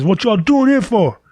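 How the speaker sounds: high, put-on voice of a hyped stranger; excited New Yorker. New Yorker asks the Detroit guys why they came, high and hyped. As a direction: play the hyped-up local grilling tourists.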